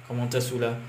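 usual speaking voice

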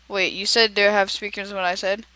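ordinary delivery

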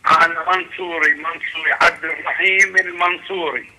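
ordinary talk

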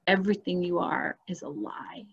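soft, intimate whisper; whispered revelation. Retold or imitated. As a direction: breathe it like a secret.

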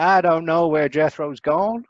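put-on hillbilly accent. Imitating puzzled locals in a bad hillbilly accent. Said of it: "my bad, uh, hillbilly accent".

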